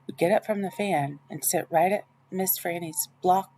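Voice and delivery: plain reading voice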